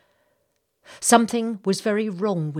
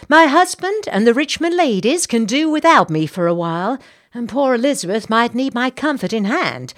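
determined Southern lady; 9 semitones higher, 10 dB louder than usual. Acted. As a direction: insist on coming along. Missus Bartlett plans.

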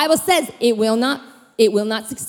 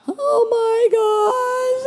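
long, high mock cry; very high, slow whimpering wail; terrified wail; 12 semitones higher, much slower than usual. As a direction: drag it out in comic terror. Acted terror at the demon voice.

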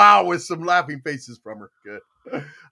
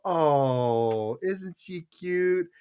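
slow, soft doting murmur; mock tender coo; 4 dB quieter, much slower than usual. Dad holding daughter's doll before punching it, mock tender. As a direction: coo slowly as if cradling a baby. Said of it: "I'd hold it like a baby".

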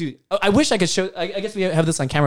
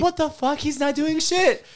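laughing disbelief; 11 semitones higher than usual. Real, not acted. Recalling absurd dance, cracking up.

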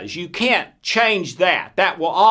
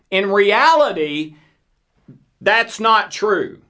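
usual speaking voice

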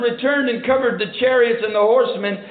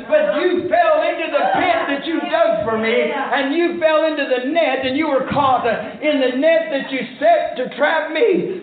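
raised-pitch defiant pulpit shout; defiant preaching; 3 semitones higher than usual. Real, not acted. Preacher tells off the devil about the pit, defiant shout. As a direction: hurl it at the devil, building in fervor.

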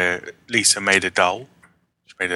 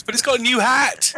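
high, bright mock crowd cry; excited crowd voice; 14 semitones higher than usual. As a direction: call it out like an eager shopper.

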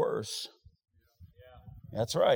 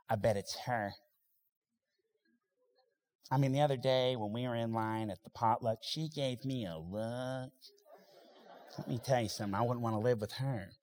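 lightly voiced gossip, low and hushed; a hint of a catty gossip at the potluck; gossiping church lady; 3 semitones lower, 5 dB quieter than usual. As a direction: dish the dirt under your breath.

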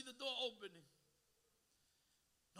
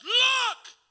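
shout; 13 semitones higher, 19 dB louder than usual. Acted. God's command in sermon.

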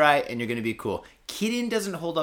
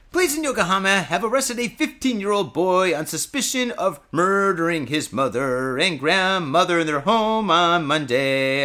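sing-song news read; 3 semitones higher, 4 dB louder than usual. Acted. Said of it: "I'm going into song".

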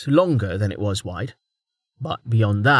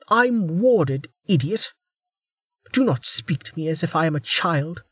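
furious; 7 semitones higher than usual. Acted. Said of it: "conceal her fury".

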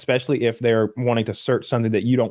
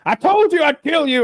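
high mock menacing voice; squeaky mocking impression of the killer; hammed-up high-pitched threat; quoting the killer; 4 dB louder than usual. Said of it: "he's like".

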